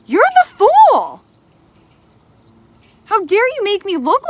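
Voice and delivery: loud, high, furious confrontation; raging at someone who betrayed you; angry shouting. Real, not acted. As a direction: yell it right at the liar.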